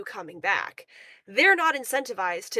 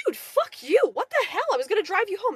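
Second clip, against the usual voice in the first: higher and louder, mock-furious outburst; retold angry yell; 10 semitones higher, 5 dB louder than usual. Telling off the guy leaving her car, retold yell. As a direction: snap back at him as if he's lost it. Retelling yelling at passenger.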